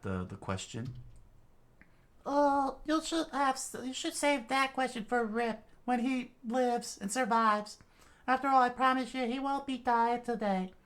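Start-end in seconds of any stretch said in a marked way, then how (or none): 2.3-10.7 put-on high girl voice, sure of herself; Latla assuring. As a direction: promise he will survive.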